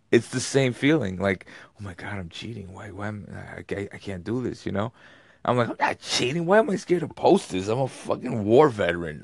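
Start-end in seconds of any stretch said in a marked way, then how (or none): 1.8-4.7 hushed mock guilt. Man agonizing privately over posting food pictures, hushed mock guilt. Acts out his guilty inner panic. 5.7-9.2 high-pitched, exasperated self-defence; mock indignant protest. War veteran scared to post a picture, protesting in mock indignation.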